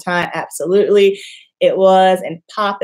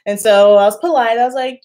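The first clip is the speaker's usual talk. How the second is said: ordinary talk, nothing marked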